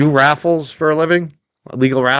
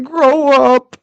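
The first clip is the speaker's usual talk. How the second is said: mocking taunt; 12 semitones higher than usual. Real, not acted. Taunting a crying commenter.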